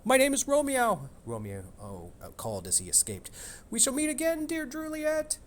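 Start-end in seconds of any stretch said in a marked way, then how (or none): 0.0-1.1 put-on high, young tomcat voice; cat voice. Romeo cat voice. 3.7-5.4 put-on high, young tomcat voice; cat voice. Romeo cat voice.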